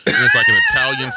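high squealing laugh through words. Real, not acted. Laugh bursts mid-word.

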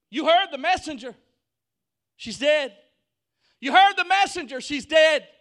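very high, very loud outcry; desperate shout. Acted. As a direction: yell it in disbelief at full force. Acted protest after bad news.

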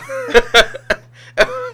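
long high burst of laughter; high rising laugh. Real, not acted. Laughing again.

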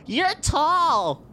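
put-on high-pitched child's voice; little kid. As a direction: pipe up high like a small kid. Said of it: "A kid walks up to you".

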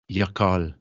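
yakal said the Spanish way, YAH-kahl; Spanish pronunciation. Shown as a demonstration. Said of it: "The word of the week is".

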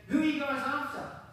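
high, loud and fearless call; bold challenge. Acted. Jesus calls to the arresting mob, loud and bold. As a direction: call out boldly to the soldiers, unafraid.

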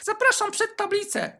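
high teacher summons. Retold or imitated. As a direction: call him up like a prim teacher. Teacher voiced in story.

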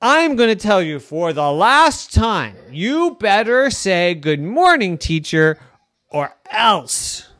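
slow, deliberate teacher threat; slow warning. Acted. Girl warns her class one last time, stern.

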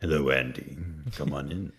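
a lightly voiced deep creepy host. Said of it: "The deep voice I liked".

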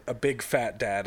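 ordinary talk

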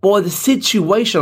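high, slow, drawn-out British outrage; mock British accent. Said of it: "people experiment with voices all the time".

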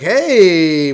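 parent calling out. Retold or imitated. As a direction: imitate a parent calling over to a kid. Parents call out to the kid.

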